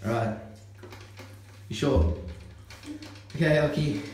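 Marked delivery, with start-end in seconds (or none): none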